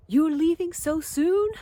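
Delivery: put-on high, loud Jamaican accent, half-hearted; a weak try at a Jamaican accent. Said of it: "I can't do the Jamaican accent for shit".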